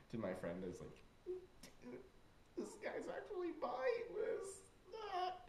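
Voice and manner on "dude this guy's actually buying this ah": hushed, high squeaky near-whisper; secretive aside. Retold or imitated. Prankster to friend behind boy's back, hushed aside. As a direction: keep it tiny so only a friend hears.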